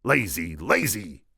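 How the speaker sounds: scolding jibe to spur someone on; goading. A narrator's reading. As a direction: goad him along. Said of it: "spurred the Sicilian".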